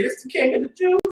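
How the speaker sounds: high scornful jeer; mock proclamation; mocking soldier. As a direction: sneer the title at him. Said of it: "and say".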